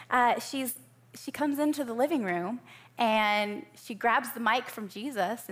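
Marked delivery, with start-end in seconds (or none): none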